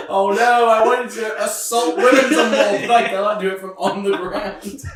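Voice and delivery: loud, high mock lament; hammed-up, loud comic complaint; mock stranded villain. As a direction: wail it like a helpless upturned turtle. Mock voice of fallen Tuppen.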